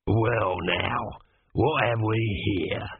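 unhurried mocking robber; menacing thief. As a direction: drawl it out like a polite cutthroat. Leading thief's voice.

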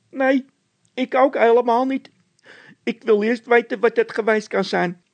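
very high, loud scared voice; a nervous squeaky henchman; squeaky frightened De Wit. A narrator's reading. (De Wit, piepend hoog en bang)

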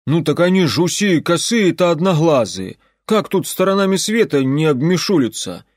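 loud, high Cossack soldier voice; rough soldier voice. Acted. Werewolf soldier voice.

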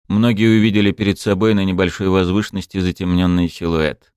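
usual speaking voice

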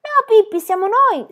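put-on high, loud frightened-child cry; shrill shriek to be recognised. A narrator's reading. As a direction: call out urgently through the door. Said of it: "strillò Annika".

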